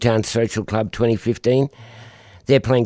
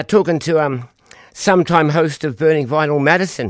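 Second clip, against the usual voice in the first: ordinary delivery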